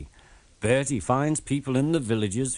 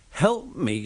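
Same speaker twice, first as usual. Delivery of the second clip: a crossly raised retort; mildly cross little engine; 6 semitones higher than usual. A narrator's reading. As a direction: sound put out and indignant. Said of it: "said Thomas crossly".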